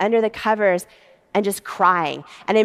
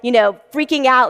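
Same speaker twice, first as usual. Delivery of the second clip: ordinary talk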